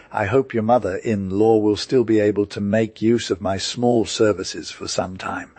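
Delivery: usual speaking voice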